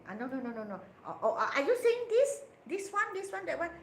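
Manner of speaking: high, loud, eager guessing; mock confused guessing. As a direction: act out groping for the right word. Acted confused back-and-forth.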